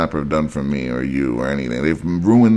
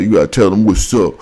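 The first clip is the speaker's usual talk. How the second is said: a touch of rapper swagger; rapper talk; 3 semitones higher, 4 dB louder than usual. Shown as a demonstration. Vlogger showing how you must talk to reach youth, mild rapper swagger. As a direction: say it like a rapper would. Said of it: "You gotta talk like this".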